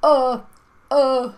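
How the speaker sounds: stuck on a stunned, high "uh"; speechless vowel. Acted. Vowels struck speechless by the spaceship, loud stunned stammer. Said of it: "the vowels are speechless".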